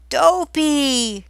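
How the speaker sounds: high, loud call across the mine; shouting a name. Acted. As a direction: yell to get his attention. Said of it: "they shouted".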